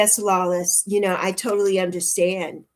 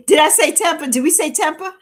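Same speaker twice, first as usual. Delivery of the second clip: high, loud laughing question; teasing laughter; 9 semitones higher, 7 dB louder than usual. Real, not acted. Teasing Temper on the live, laughing and high-pitched. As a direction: ask it through rising giggles.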